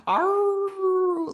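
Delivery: high, sustained coyote-style howl; long mimicked "arooo"; imitated loud howl. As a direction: howl like someone calling back to coyotes. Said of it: "really loud".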